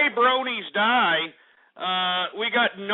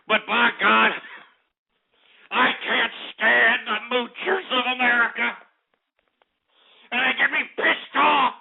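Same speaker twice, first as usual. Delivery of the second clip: heated rant, climbing higher to a shout; barely contained rage; 5 semitones higher than usual. Real, not acted. Host railing at moochers of America, barely contained rage. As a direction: build from indignation into raging. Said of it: "sometimes it's hard to hold in my anger".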